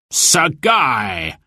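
sheriff's shouted hail; theatrical shout of a name; crying out. A narrator's reading. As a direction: call out to an ally across the field. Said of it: "cried the sheriff".

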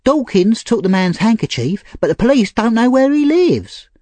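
put-on high-pitched girl reporting news; urgent Nancy. A narrator's reading.

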